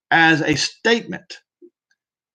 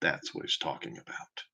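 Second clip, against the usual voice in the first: whispered aside; 14 semitones lower, 17 dB quieter than usual. Real, not acted. Hushed aside to listeners.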